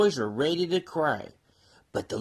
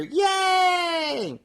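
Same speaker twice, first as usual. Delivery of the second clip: high, bright cheering yay; 12 semitones higher than usual. Acted. Toys cheering as the train starts off, excited. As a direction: whoop it like a crowd of happy toys. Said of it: "began to smile and cheer".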